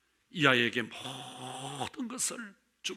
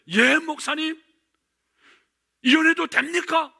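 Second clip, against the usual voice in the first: high, louder eager question; 12 semitones higher, 8 dB louder than usual. Retold or imitated. Deacon leaping up to ask the pastor, eagerly raised. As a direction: jump at the chance.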